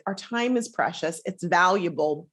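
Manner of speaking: normal talking voice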